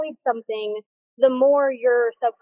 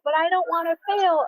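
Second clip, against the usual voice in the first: higher-pitched imitation of reluctant people; mimicked worried excuse; 6 semitones higher than usual. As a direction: lightly act out others' worried objection.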